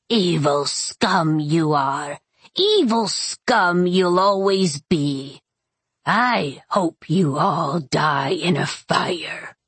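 slow, drawn-out witch curse; spiteful old witch. Acted. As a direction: spit out a curse, sinking into a rasping whisper. Voices Maria cursing after spitting.

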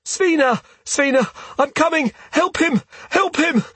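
high, urgent yelling; panicked yell. A narrator's reading. Half-asleep Grommel yelling to save Svina, frantic high yell. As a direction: cry out for help, high-pitched and frantic. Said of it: "flails his arms wildly and yells".

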